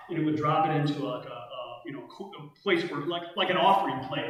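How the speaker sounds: usual speaking voice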